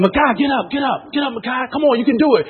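high-pitched cheering from the sidelines; retold cornerman's pleas; urgent encouragement. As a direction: urge a fighter back up, high and strained. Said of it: "I'm trying to encourage him".

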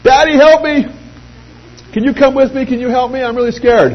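high, frantic pleading as the scared daughter; panicked daughter. Retold or imitated. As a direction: imitate the daughter begging Daddy for help. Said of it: "She starts freaking out".

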